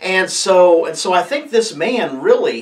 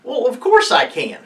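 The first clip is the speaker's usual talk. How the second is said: Jesus, retorting; 7 semitones higher than usual. Retold or imitated. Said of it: "he's saying, you know".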